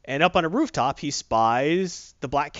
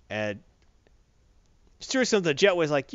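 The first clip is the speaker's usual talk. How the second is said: normal talking voice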